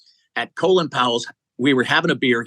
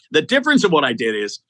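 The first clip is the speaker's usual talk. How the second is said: ordinary talk, nothing marked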